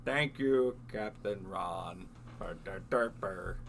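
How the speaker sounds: slow, low robotic imitation; mock a glitchy robot voice. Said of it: "You're like".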